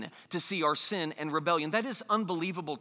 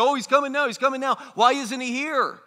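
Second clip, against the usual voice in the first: higher, louder mocking mimicry; taunting; 5 semitones higher, 6 dB louder than usual. Preacher voices skeptics parroting each generation's hope, taunting. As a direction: parrot the believers, then challenge them. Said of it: "skeptics today taunt Christians".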